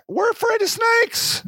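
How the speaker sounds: very high, whiny scared voice; mock frightened whine. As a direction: whimper it like a spooked city kid. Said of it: "You're like".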